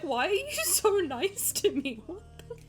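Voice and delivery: retelling herself half laughing, half crying; giggly teary disbelief, high and loud; laughing quote. Retold or imitated. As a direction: laugh through a teary what. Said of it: "and I was like".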